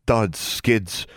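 usual speaking voice

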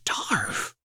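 breathy whispered question. Acted. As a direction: whisper it coldly. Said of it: "Her voice was a whisper".

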